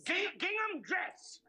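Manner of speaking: high, drawn-out indignant retort. Acted. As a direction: splutter it back, offended. Stung retort in a marital row.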